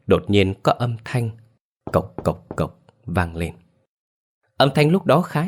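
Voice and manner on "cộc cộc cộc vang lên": ordinary delivery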